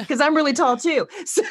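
amused, laughing as you talk. Real, not acted. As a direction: laugh your way through the words. Laughing at own joke.

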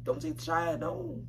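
ordinary talk, nothing marked

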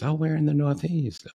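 high, mimicked probing question; imitated curious agent.

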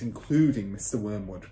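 ordinary delivery, nothing marked

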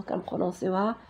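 normal talking voice

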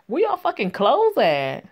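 high, loud, drawn-out shout; incredulous demand. Real, not acted. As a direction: call it out high, loud and slow. Reader shouts at the characters.

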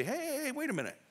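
sudden high-pitched "hey, hey, hey, wait a minute"; protesting owner. Retold or imitated. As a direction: play the startled owner calling out to stop the men. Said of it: "cared enough about their colt to say".

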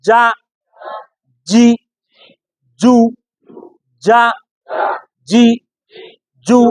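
"যা", "জি", "জু" each alone with a firm mid-tongue j; hard jim. Shown as a demonstration. Teacher has students echo, hard "যা, জি, জু". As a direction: drill "যা, জি, জু" as separate hard syllables. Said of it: "জিহ্বার মাঝখান থেকে শক্তভাবে".

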